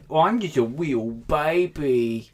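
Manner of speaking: put-on slow, high babyish whine. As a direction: coo like a little baby. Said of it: "that's my baby voice".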